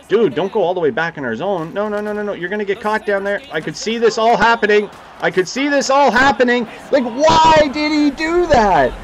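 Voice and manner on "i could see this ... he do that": exasperated. Real, not acted. Gamer ranting at his CPU teammate, high exasperated whine. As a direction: vent at the dumb teammate.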